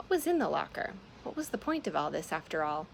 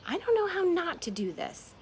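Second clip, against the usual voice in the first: high, louder panicked cry; panicked inner scream; 12 semitones higher, 4 dB louder than usual. Acted. Her own brain screaming back at her, high and loud. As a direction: let the panic burst out high. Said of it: "Her brain screamed back at her".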